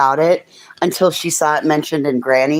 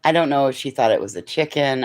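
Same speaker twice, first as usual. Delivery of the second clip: ordinary talk, nothing marked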